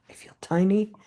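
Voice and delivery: a small whisper, shrinking; whispered unease. Retold or imitated. In a huge church, she whispers to herself that she feels tiny. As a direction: whisper it as if swallowed by the room. Feeling lost in megachurch.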